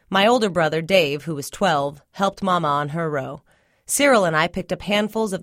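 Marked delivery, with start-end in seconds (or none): none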